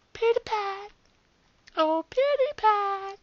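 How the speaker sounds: very high sing-song call; teasing falsetto. Pretend. Killer player taunting the next victim, high falsetto call. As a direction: coo the name slowly, way up in falsetto. Mockingly calls the intended victim.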